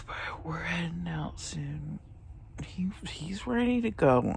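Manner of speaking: hushed, tired whisper. Real, not acted. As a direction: whisper the news quietly. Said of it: "That's my confession".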